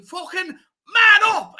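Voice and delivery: high, loud, drawn-out mock yell; imitated footballer's shout. Player yelling at a teammate, heard from the upper tier, imitated shout. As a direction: imitate a player bellowing across the stadium. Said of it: "I could hear him from the upper tier".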